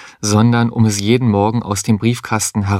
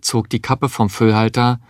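ordinary delivery, nothing marked

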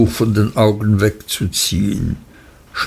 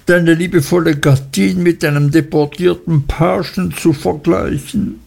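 higher, mildly tearful reproaches; understated tearful Dora; lamenting young wife; 5 semitones higher than usual. Acted. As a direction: wail at him, hurt and accusing, lightly.

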